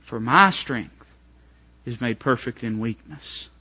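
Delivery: a hushed, tender God answering Paul; God speaking gently. Acted. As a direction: comfort Paul quietly as God. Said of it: "the Lord looked at him and said".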